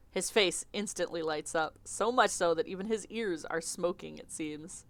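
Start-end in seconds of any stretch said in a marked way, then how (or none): none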